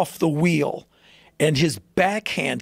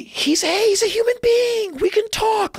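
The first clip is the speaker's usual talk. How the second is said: mocking Trump. Mocking Trump defending Putin in a high whiny voice. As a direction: ham up a naive protest in a high pitch. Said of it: "when Trump".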